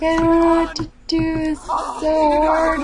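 high, hammed-up helpless wail; mock freaking out. Player voicing the overwhelmed father while the baby is coming, mock panic. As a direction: drag out the panicked words, high and whiny. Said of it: "He's freaking out".

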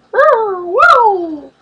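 high, drawn-out "woo" in a put-on voice; silly-voiced woo. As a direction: let out a long, silly, falling whoop. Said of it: "I don't know why I just did that voice".

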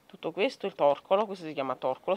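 usual speaking voice

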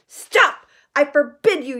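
high loud panicked yell. A narrator's reading. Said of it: "yelled Uncle Vernon in panic".

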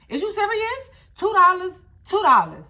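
high, loud disbelief; incredulous outburst. Retold or imitated. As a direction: pitch it up in mock disbelief at the small sum. Mocking the dancer's outrage.

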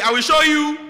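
loud, high menacing promise; loud and high, promise trouble; mom's threat. Retold or imitated. Nigerian mother warning her child, loud and high. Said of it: "they will just tell you".

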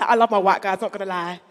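usual speaking voice

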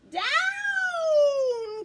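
loud, high, drawn-out shout; witch's cry. Acted. Witch ordering the broomstick to the ground, crying out. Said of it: "cried the witch".

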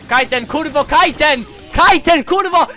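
frustrated yelling. Real, not acted. Gamer yelling a move at an unresponsive character, frustrated. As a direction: yell the move name in growing exasperation. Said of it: "Krzyczę ci".

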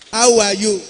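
How are you asked loudly, HOW stressed and high; prompting question. Shown as a demonstration. Pastor cueing the boy to answer, loud clear prompt. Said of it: "When I say, "How are you?".

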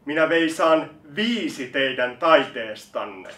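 slow, loud proclamation; loud declamation. Acted. Reciter announces singing of their art, loud declamation. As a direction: declaim it slowly at full voice.

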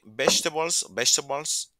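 vegetables said slowly as bestebols; teacher's model take of vegetables; vegetables model pronunciation. Shown as a demonstration. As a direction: repeat vegetables carefully, slow on the first take. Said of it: "es una pronunciación aceptable".

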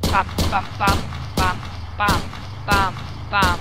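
loud rapid bam bam gun sounds; loud gunfire bams. Shown as a demonstration. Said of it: "Bei den Japanern".

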